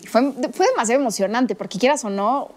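ordinary talk, nothing marked

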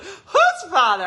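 very high, loud, slow squeak; imitating grandmom asking whose father; grandma voice. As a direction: squeak it like a baffled tiny grandma. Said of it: "she was like".

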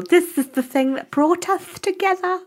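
high, far-off sentimental voice; sappy heroine. Pretend. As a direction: coo it like a doting romantic. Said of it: "Like, it's ornamental".

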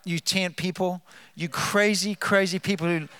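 ordinary talk, nothing marked

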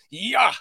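fighting cry, briefly demonstrated; demonstrated "yeah" yell. Shown as a demonstration. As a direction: imitate the opening yell. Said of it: "including the".

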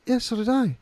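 high-pitched comic retort; mock-affronted comeback, pitched high; retold indignant reply. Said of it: "And I'm like".

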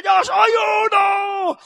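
huge, high, drawn-out rallying yell; full-out shout. Retold or imitated. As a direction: leap in and bellow it, stretching the words. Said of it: "entonces ya gritaban ... yo me levantaba". (grito a todo pulmón, agudo y alargado)

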